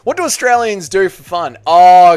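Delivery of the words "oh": loud, bright "Oh" before the list; excited. Real, not acted. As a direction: launch into the answer with gusto. Answers the Australian fun question.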